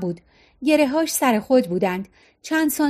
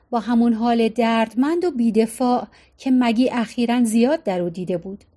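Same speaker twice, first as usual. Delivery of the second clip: ordinary delivery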